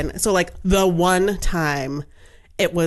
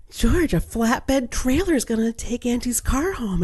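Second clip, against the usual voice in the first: gasp, then higher, drawn-out thrill; excited announcement; 5 semitones higher than usual. Retold or imitated. Mother tells truck-loving George about the flatbed, excited. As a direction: build up the big news for a small child.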